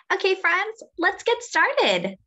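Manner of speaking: high, bright-eyed welcome to the class; rally the little ones to start; cheery teacher. Real, not acted. Teacher opening a read-aloud.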